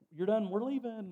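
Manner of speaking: lightly voiced kids waving him off; kids dismissing him. Kids at church sending him home, mock dismissive. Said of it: "they're just like".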